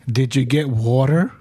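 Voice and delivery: usual speaking voice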